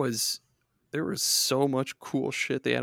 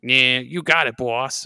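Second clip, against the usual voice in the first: cartoon henchman voice; 6 dB louder, slower than usual. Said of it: "the weird cartoons where it was like".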